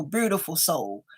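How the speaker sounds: ordinary talk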